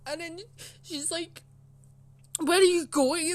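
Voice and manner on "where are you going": loud, raised-pitch retold shout; shouting down the hall. Teacher to pupil leaving in tears, shouting down the hall. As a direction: call sharply after someone walking away. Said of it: "she was shouting down the hall".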